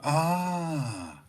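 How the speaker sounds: long, high, soft "aah"; imitated "ah" of realisation. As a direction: draw out a mock "aah" as listeners catching on. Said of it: "follow you on social media are like".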